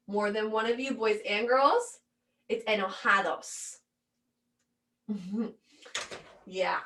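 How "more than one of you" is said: ordinary talk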